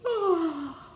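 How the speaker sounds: high falling yawn; a long yawn for them to copy. Shown as a demonstration. Yawning along with the children. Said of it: "Can you yawn with me?".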